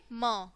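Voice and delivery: म as "muh", consonant plus short vowel; letter sound demo. Shown as a demonstration. Teacher showing the sound of M, letter sound demo. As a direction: sound out म on its own. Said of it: "क्या बोलेंगे".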